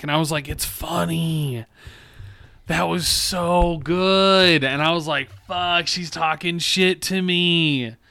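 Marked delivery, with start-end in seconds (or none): 0.5-4.6 high, very drawn-out relish; retold gushing praise. Boss insisting to a coworker that it's funny, gushing. 5.5-8.0 high, slow, stretched, ending in a holler; retold exasperated admiration. Boss mocked by an employee but unable to be mad, admiring outburst.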